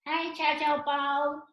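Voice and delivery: high, slow, cute cartoon voice; small comic character. Comic character greets the little bao, high cartoon voice.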